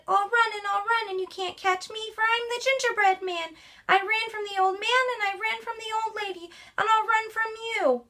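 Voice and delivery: high, boastful gingerbread man taunting; high cheeky runaway cookie; cocky gingerbread man. Acted. Gingerbread man voiced in story.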